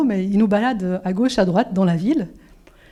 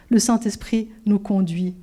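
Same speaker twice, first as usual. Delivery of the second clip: normal talking voice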